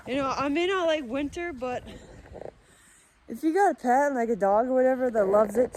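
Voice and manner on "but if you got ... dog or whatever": ordinary delivery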